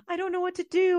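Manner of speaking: distraught, breaking down at her sister's door; crying. Retold or imitated. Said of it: "showed up at her door crying".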